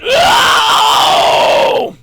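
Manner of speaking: long very high loud scream; held high scream. Shown as a demonstration. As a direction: take a breath and hold the scream. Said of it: "learn to hold that".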